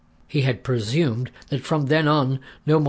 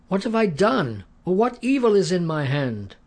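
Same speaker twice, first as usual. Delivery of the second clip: pleading call; 7 semitones higher than usual. A narrator's reading. Pleading question to the king.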